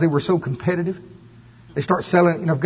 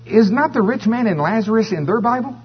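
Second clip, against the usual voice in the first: higher, mock-indignant comeback; incredulous retort; 8 semitones higher than usual. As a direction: toss it back at the critics in disbelief. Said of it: "And I'm going".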